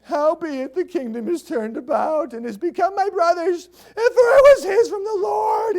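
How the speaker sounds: high whining wail rising to a shout. Acted. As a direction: wail it like a spoiled prince crying for his toy. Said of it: "he's just whining".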